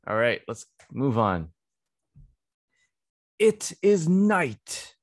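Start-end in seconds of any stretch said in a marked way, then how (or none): 3.4-5.0 story reading voice. Shown as a demonstration. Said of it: "One more time".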